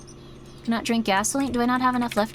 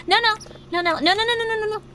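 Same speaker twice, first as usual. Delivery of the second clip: 1 panicked no's; 11 semitones higher, faster than usual. Real, not acted. Panics at the Molotov.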